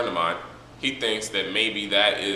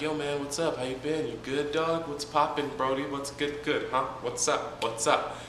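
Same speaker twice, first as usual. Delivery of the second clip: higher, soft, easygoing bro voice; casual bro voice; 6 semitones higher, 5 dB quieter than usual. Retold or imitated. Madara greeting grieving Hashirama, casual bro voice. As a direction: greet him like a chill homie. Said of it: "he's like".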